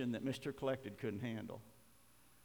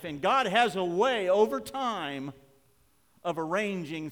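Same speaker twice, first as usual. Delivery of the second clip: loud, raised preacher's voice; forceful preaching; 7 semitones higher, 10 dB louder than usual. Real, not acted. Preacher urges congregation to bring troubles to God, forceful preaching. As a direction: drive it home to the congregation, loud and high.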